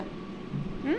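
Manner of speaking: ordinary talk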